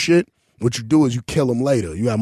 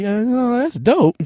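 higher, bright mock-impressed praise; fake enthusiasm; 10 semitones higher, slower than usual. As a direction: pretend to be impressed. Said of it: "I be like".

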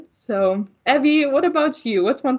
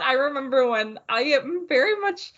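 usual speaking voice

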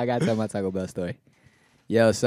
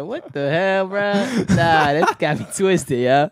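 shocked outrage; 8 semitones higher, 5 dB louder, slower than usual. Retold or imitated. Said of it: "I said".

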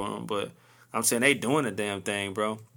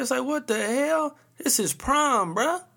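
pitched way up, "what the hell" in mock disbelief; incredulous; 11 semitones higher, slower than usual. Reacting to kids' lavish proms, high incredulous squeal. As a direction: squeal it like you can't believe it.

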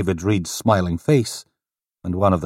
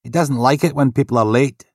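polite desk sergeant, a bit higher; lightly voiced desk sergeant; 7 semitones higher, 5 dB louder than usual. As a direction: greet him with polite formality.

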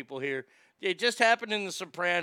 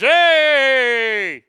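high, loud barked hey; a guy hailing his buddies as one walks in, loud; guy's loud hey; 7 semitones higher, 14 dB louder than usual. Retold or imitated. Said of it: "I just know it's".

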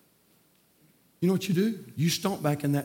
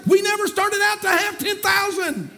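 preaching at full force. Acted. Preacher to his congregation about telling the truth, shouted preaching. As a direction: hammer the truth home to the church.

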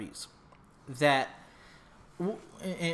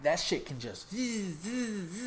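imitated sliding noise; 4 semitones higher, 5 dB quieter, slower than usual. Showing the wood sliding off.

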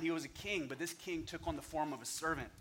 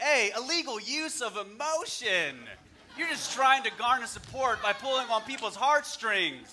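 loud, higher penalty call like a ref; a referee calling a foul on the speaker; mock referee call; 7 semitones higher, 9 dB louder than usual. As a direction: throw the flag and announce the foul, loud. Mock referee flags emotional appeal.

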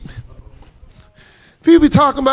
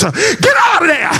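preaching call; 8 semitones higher, slower than usual. Acted. Urging believers out of shallowness.

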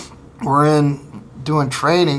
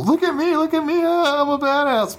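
much higher, cocky mock boast; mock badass bravado; 12 semitones higher than usual. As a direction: ham up a swaggering young soldier showing off. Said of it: "like, the whole".

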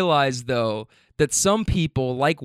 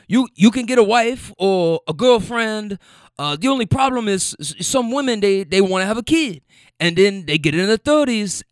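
put-on higher, in a South American accent; 5 semitones higher than usual. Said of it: "so I'm trying to do the accent".

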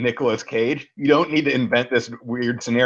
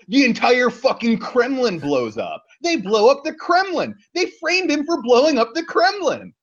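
rising, incredulous excitement; excited; 12 semitones higher than usual. Real, not acted. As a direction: build the disbelief higher and higher. Disbelieving plot recap, Kremlin blown up.

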